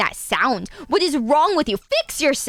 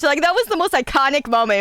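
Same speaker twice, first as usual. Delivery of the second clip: ordinary delivery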